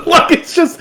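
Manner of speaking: high laugh into words. Real, not acted. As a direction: laugh then talk. Laughing at the skit.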